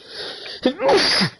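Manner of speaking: loud sudden real sneeze; a violent sneeze bursting out. Real, not acted. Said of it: "that's what sneezing feels like".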